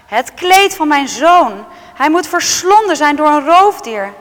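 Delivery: high, loud anguished cry; the father's shocked outcry over his son's coat; crying out in grief. Acted. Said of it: "riep uit". (luide, hoge wanhoopskreet)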